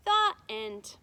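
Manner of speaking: loud high announcement; ending the story with a flourish; dramatic "the end". Acted. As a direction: boom out the end of the tale.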